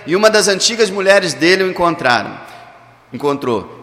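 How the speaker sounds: ordinary delivery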